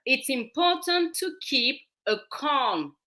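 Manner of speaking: slow, carefully spaced words; slow right down to be understood; speaking slowly. Shown as a demonstration. Guest repeating her point for the host, slowly. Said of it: "let me speak slowly".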